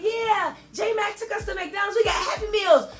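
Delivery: imitated little son, high and excited; happy baby voice. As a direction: squeal the news like a thrilled small child. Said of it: "sounding so happy".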